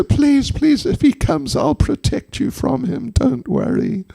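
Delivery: high-pitched, insistent pleading; Jatila begging. Acted. Old woman promising the doctor protection, begging. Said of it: "Jatila begged her".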